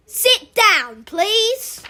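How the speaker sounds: loud, firm command, "please" pitched high; strict teacher's order. Acted. Strict teacher to shouting pupils, loud order. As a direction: order them to sit, brooking no argument.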